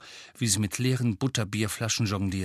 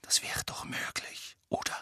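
lowered voice; 9 dB quieter than usual. A narrator's reading. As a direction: drop to a whisper so nobody overhears. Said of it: "senkte die Stimme".

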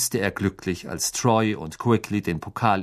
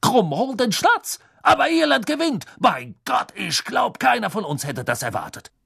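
stunned commentator; 12 semitones higher than usual. Acted. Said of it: "rief Beckman".

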